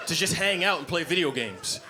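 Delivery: ordinary delivery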